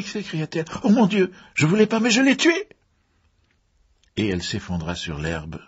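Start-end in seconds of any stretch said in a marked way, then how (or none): 0.8-2.7 very high, loud hysterical confession; panicked cry. A narrator's reading. Diana confesses she killed him unwillingly, panicked cry. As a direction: wail it loud, climbing in panic. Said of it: "Diana poussa un cri affolé".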